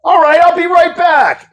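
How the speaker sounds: high, strained-cheerful reply; reluctant compliance. Retold or imitated. Husband agreeing to fetch the cans, high-pitched compliance. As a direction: call it out high to the wife. Puts on bravado for his wife.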